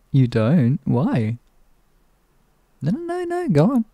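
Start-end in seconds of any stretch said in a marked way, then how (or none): 2.8-3.8 sing-song. Acted. Playful mock protest.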